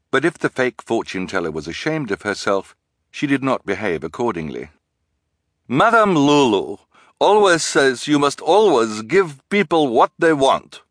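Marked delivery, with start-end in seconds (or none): 5.7-10.8 high, loud, grand proclamation; grand disguised voice. A narrator's reading. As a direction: proclaim it in a put-on fortune-teller voice. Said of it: "grandly in her disguised voice".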